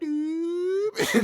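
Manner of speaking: high squeaky "doop" sound effect; a cartoon needle shooting up with a high yelp; pain meter spiking. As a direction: mimic the pain meter jumping, high and sharp. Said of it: "my brain went over to the pain meter and just went".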